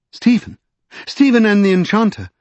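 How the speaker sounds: high-pitched urgent cry; alarmed cry. A narrator's reading. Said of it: "cried Lady Pole".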